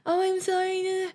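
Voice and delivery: high flustered mock apology; high-pitched imitation of an awkward apology; mock flustered girl. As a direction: play her as a flustered, fake-sorry girl. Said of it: "she's just like".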